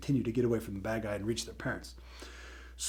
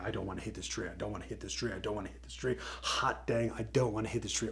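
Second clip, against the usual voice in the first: soft repeated muttering to himself; a quiet anxious mutter, over and over; nervous mantra; 3 semitones lower, 6 dB quieter, faster than usual. Acted. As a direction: chant it low like a frightened prayer. Tim repeating fearful mantra.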